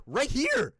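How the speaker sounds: high, loud insistence; jabbing at the screen, yelling right here; exasperated shout. Retold or imitated. Showing the teacher the pinned post.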